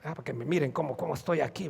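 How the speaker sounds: low, softly muttered mock attention-seeker; hushed aside in a pretender's voice; mock show-off. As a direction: quietly imitate someone showing off their tears. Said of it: "Para que lo vieran los demás".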